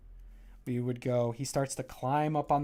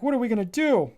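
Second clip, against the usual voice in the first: worried pillows; 9 semitones higher, 8 dB louder, faster than usual. Acted. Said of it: "And they're like".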